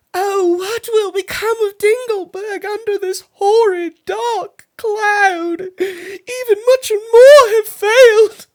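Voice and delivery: high, crying wail of despair; tearful princess. Acted. Princess despairing to the barber, crying. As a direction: weep out the lament, voice breaking.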